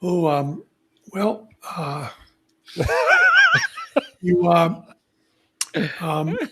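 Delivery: halting, tactful stalling between pauses; searching gently for words, in mock reluctance; hesitant teacher. Teacher reacting to a student's bad accent, acted hesitant. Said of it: "the teacher went".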